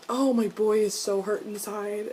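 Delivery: usual speaking voice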